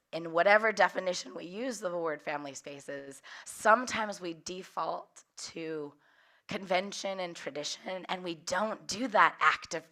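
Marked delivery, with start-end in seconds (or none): none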